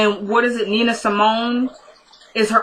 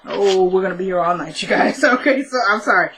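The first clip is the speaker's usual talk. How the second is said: ordinary talk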